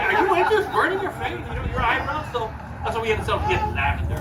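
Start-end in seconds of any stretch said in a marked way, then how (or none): 0.0-2.3 high, incredulous teasing question; mock disbelief.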